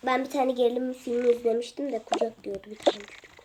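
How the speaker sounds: ordinary delivery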